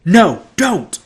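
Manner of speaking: high, loud desperate scream; loud panicked scream. A narrator's reading. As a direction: scream to stop him. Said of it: "Annabeth screamed".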